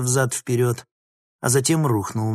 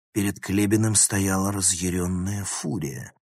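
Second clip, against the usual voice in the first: usual speaking voice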